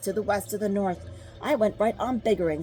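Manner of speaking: plain reading voice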